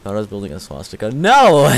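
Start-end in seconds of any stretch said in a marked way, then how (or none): 1.1-1.7 loud, high cry of refusal; appalled outburst. Real, not acted. Streamer rejecting what the build looks like, appalled. Recoils at shape they built.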